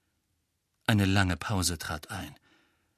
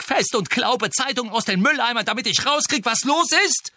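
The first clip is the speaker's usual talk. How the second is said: high, loud bitter yelling; furious shout; 12 semitones higher, 5 dB louder than usual. A narrator's reading. Harry yells at friends who left him alone. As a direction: spit the grievance out at them.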